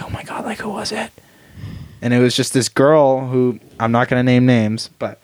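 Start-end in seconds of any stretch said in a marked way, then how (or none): 0.0-1.1 hushed surprise. Retold or imitated. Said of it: "And I was like".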